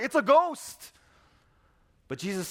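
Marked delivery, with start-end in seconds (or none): 0.0-0.9 high, loud startled shriek; a gasp of terror at a ghost; frightened cry. Acted. Disciples spot a figure on the lake, high frightened cry.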